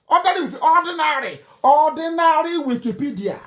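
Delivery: ordinary pushed high each time; emphatic insistence. Real, not acted. The preacher insisting his listeners look it up, emphatic and high. Insisting the facts are easy to find.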